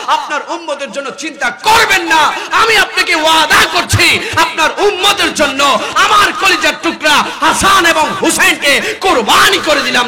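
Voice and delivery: high, full-force preaching shout; impassioned proclamation. Retold or imitated. Preacher as Fatema pledging her sons, impassioned shout. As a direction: thunder the vow to a climax.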